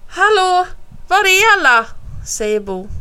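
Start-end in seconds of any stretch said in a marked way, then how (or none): 0.1-1.8 high, loud searching call. A narrator's reading. Bo calls out for everyone in the flood, calling out. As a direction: shout to an empty house. Boy calls for missing family.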